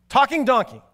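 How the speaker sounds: ordinary delivery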